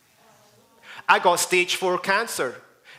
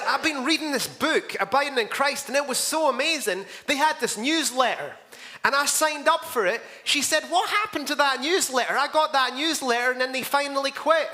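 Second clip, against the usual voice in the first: higher-pitched imitation of eager chatter; retold enthusiasm; 7 semitones higher than usual. Lady tells pastor about a beloved book, mildly excited. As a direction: gush lightly, as if quoting her.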